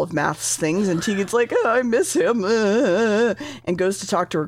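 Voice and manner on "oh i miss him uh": hammed-up moping wail; mock whining. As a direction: whine it like fake crying over someone missed. Acting Tegan missing someone.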